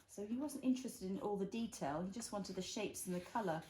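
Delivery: ordinary delivery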